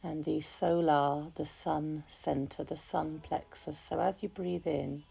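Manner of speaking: calm, unhurried meditation voice, softening gradually; guided meditation. Real, not acted. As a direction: guide listeners gently into relaxation, leaving long pauses. Leads a relaxation meditation.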